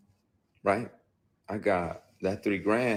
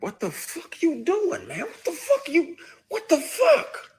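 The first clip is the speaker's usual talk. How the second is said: high, laughing shriek, pushed far up; hammed-up panicked squeal, climbing ever higher; squealing freak-out; 6 dB louder than usual. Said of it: "And you spaz even more".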